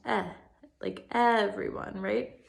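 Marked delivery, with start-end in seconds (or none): 0.1-0.3 short vowel "eh" said alone; short e sound. Shown as a demonstration. Teacher modelling the short e vowel sound for children. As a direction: isolate the sound "eh" cleanly. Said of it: "makes the short E sound".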